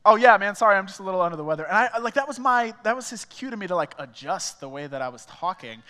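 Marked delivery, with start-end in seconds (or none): 0.0-1.7 loud, fast mock excuse; caught-out teen blurting an excuse; hasty excuse. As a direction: rattle off a sheepish cover story. His quick excuse to friend.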